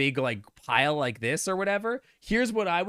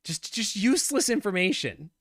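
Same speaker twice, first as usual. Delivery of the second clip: ranting; 5 semitones higher, 4 dB louder than usual. Real, not acted. Ranting at the quiz.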